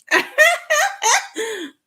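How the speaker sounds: sudden high-pitched cackle of disbelief; mocking high laugh. Real, not acted. Mocking Tay's fake accent.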